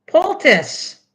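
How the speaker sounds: half-joking poultice pronunciation; mock poultice. Shown as a demonstration. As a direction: pitch "Poultice" up high in a mock tone. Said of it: "still doesn't seem like right".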